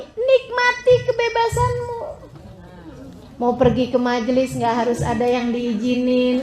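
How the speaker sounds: ordinary talk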